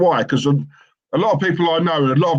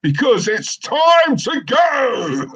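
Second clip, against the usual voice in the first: high, slow, drawn-out pilot shout; hammed-up launch call with stretched final word; mock pilot cry; 9 semitones higher, much slower than usual. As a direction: wail the slogan as an over-the-top pilot. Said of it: "that's the pilot saying".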